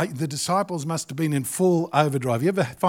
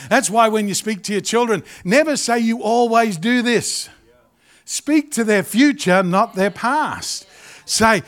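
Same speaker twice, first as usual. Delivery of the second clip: raised, emphatic preacher voice; preaching; 5 semitones higher, 4 dB louder than usual. Acted. Pastor urging parents, preached with force. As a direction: press the point home from the pulpit.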